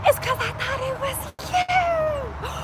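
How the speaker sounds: very high, drawn-out melodramatic wail; movie scene reenactment. Acted. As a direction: squeal it slowly like a courtroom confession. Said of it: "that was that scene for real".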